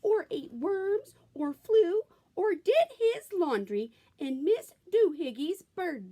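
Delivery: high-pitched scolding mama; scolding mother. A narrator's reading. Mother scolding her son about birds, high voice. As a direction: scold him like an exasperated mother. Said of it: "she scolded".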